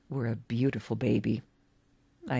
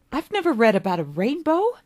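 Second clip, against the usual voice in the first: put-on excited boy David; 9 semitones higher, 5 dB louder, faster than usual. David amazed by rainbow.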